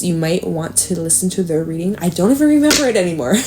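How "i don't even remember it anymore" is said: normal talking voice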